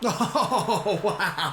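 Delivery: laughing at a brilliant goal; delighted laugh. Real, not acted. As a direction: burst out laughing with joy.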